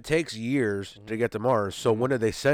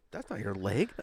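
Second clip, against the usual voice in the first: quiet, low quoted aside; hushed realization; 5 semitones lower, 8 dB quieter than usual. Retold or imitated. Man quietly questioning his date in the dark bed, hushed. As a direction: say it under your breath, as in a retold discovery.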